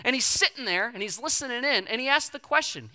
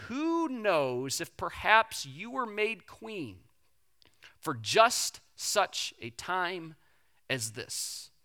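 slow, hushed, solemn delivery; profound quote; 3 semitones lower, 4 dB quieter, much slower than usual. Retold or imitated. Mordecai urging Esther, slow and hushed. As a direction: let each phrase land slowly. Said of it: "the most profound statement".

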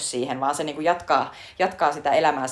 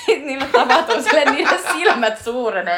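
laughing retelling; 10 semitones higher, 6 dB louder than usual. Retold or imitated. Retelling film scene, laughing.

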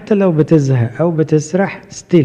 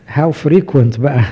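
slow teasing; 3 dB louder, much slower than usual. Acted. Rhetorical teasing question.

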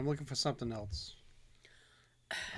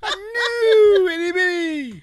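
squealing shout; 13 dB louder, much slower than usual. Pretend. Shrieking squeaky exaggeration.

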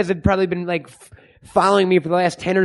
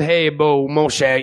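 lower, lightly hammed-up Cajun accent; a mild mock Cajun drawl, like a cartoon bayou man; 4 semitones lower, slower than usual. Host mocks the X-Men Gambit voice in a light Cajun accent. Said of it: "just that stereotypical Cajun right there".

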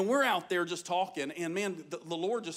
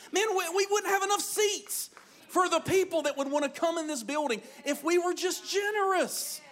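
high-pitched, full-force sermon delivery; fired-up preacher; impassioned preaching; 7 semitones higher than usual. Real, not acted. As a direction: exhort the congregation with rising fervor.

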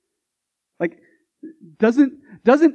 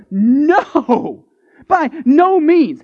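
slow, louder, higher burst of no; explosive no; 6 semitones higher, 5 dB louder, much slower than usual. Acted. Paul recoiling from the question, loud slow no. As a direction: explode with abhorrence. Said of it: "Paul goes".

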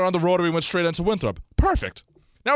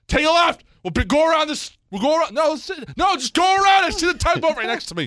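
higher, louder, urgent pleading to go around; frantic urging; 10 semitones higher, 3 dB louder than usual. Retold or imitated. To his girlfriend in traffic, pushing her to go around. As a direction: rush her to go around the boat.

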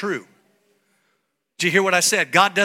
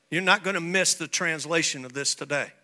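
usual speaking voice

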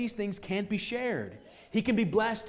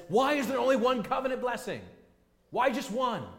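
whiny demand; 6 semitones higher than usual. Acted. Esau demanding of his father.

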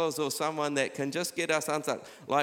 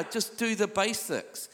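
plain urging; 5 semitones higher, slower than usual. Real, not acted. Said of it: "Come on, do the basics".

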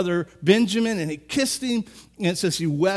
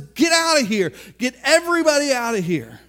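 loud overwhelmed order to clear the room; shout; 4 semitones higher, 4 dB louder than usual. Retold or imitated. Joseph orders the Egyptians out, loud overwhelmed shout. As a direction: bark it out, at the end of his rope.

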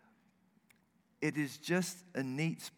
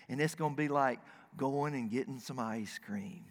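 ordinary talk, nothing marked

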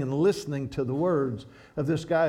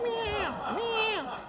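high, drawn-out cat meows; meowing like a cat; 4 dB quieter than usual. Retold or imitated. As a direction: mimic a catbird's meow. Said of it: "It'll do this cat sound".

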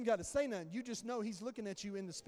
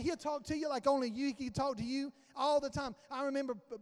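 ordinary talk

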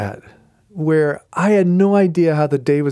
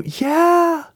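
excited yeah; 11 semitones higher than usual. Real, not acted. Celebrating God moments.

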